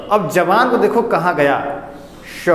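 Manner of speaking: ordinary talk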